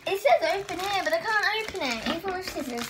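real exasperation; frustrated whine. Real, not acted. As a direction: whine at the stubborn packaging. A frustrated struggle to open it.

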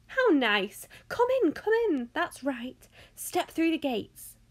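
loud, high, effusive greeting; fluty host bubbling over with excitement; excited. A narrator's reading. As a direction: gush a delighted welcome. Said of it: "Mister Wonka was clearly just as excited".

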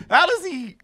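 high incredulous question; amazed. Retold or imitated. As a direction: marvel at the joke. Retold amazed reaction.

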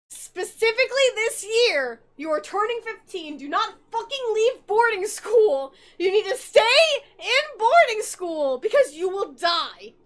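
loud, slow, emphatic mock warning from a frantic mother; over-the-top maternal shouting; shouting in all caps. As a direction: bellow each word like a letter typed in capitals. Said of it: "All caps lock".

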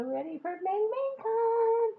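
high, rising fan squeal; bursting with fan excitement; excited squeal. Real, not acted. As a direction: squeal with concert hype. Hyped for the concert.

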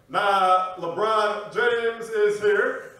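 casual, drawn-out dad-joke delivery; Barack Obama. Acted. President joking among his friends, slow dad joke. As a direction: stretch it slowly, joking with the boys. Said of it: "he'll make a dad joke".

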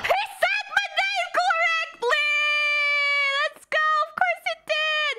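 high, thrilled squeal of delight; real excited yelp; excited shout. Real, not acted. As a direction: shout it out, overjoyed her name was said right. Hears her name said right.

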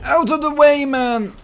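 very high, very loud yell; urgent shout. Real, not acted. Player yelling at another player to move, urgent shout. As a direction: yell it urgently at top pitch. Calling out during the battle.

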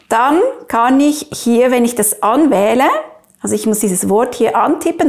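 ordinary talk

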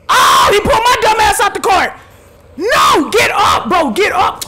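high, loud half-crying outrage; outraged shout. Real, not acted. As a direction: wail it as if robbed in public. Pulled out of golf cart.

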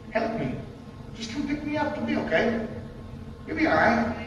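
the snake luring the congregation, coaxing; tempting snake. Acted. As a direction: lure them in gently.

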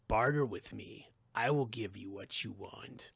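tempting voice from the abyss; ancient evil voice. Acted. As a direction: bargain like something evil below. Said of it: "it said".

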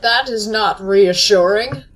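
slow, loud sarcastic remark; loud dry sarcasm. Real, not acted. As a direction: announce it with dry alarm. Alarmed by enemy.

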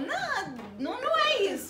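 high-pitched defensive protest; flustered, high denial; annoyed. Real, not acted. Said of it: "por que que você tá brava?".

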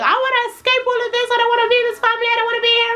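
high, fast, whiny sing-song lament; melodramatic wail. Retold or imitated. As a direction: play the tragic teen. Dramatic excuse to grandma.

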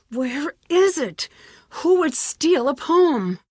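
alarmed disbelief. Acted. Mother's poem found missing.

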